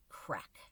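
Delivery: breathy crack sound; whispered crack. Acted. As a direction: crack like a snapping branch. Branch snaps.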